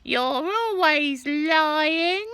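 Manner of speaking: put-on very high, loud accusing old woman; sneering grandma shouting; Grandma yelling. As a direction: scold him from above. Grandma scolding George.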